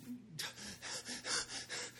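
soft, breathy mock panting; acted out-of-breath gasps; imitated panting. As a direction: huff and puff like a winded child. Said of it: "he was out of breath".

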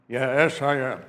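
loud, put-on brother's voice; Esau. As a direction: insist loudly in your disguised voice. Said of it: "he didn't like my voice".

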